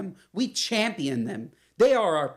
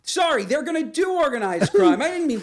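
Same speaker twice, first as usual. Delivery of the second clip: excited correction; 9 semitones higher, 5 dB louder than usual. Real, not acted. Jokey correction.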